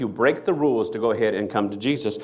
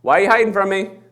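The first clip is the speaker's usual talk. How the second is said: high, loud calling out; God calling out; 10 semitones higher, 8 dB louder than usual. Acted. God calling to Adam and Eve in the garden, high and loud. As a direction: call out like searching for someone hidden. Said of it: "and God would say".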